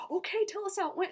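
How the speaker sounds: high, loud mock-interviewer prompt; perky interviewer. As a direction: imitate a chirpy camera crew prompting a fan. Voicing the camera crew.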